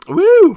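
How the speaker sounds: loud high hoot of delight; excited hoot. Real, not acted. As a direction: hoot with pleasure over the food. Savoring a bite of meat.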